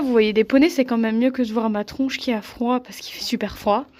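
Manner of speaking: ordinary talk, nothing marked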